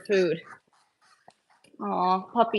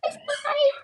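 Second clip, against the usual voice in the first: much higher greeting to puppies; high pet voice; high pet cooing. Real, not acted.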